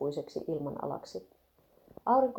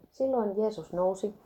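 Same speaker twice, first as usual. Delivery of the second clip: usual speaking voice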